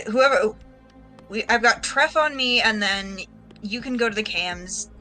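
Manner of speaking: ordinary delivery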